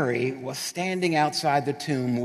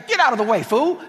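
loud, high brush-off; bossy shout; 8 semitones higher, 7 dB louder than usual. Retold or imitated. Mother clearing kids aside, shouting. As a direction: shove past with a bark. Mother pushing through crowd.

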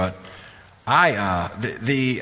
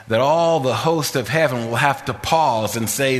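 preaching cadence; 4 semitones higher, slower than usual. Retold or imitated. Quoting King, preaching cadence.

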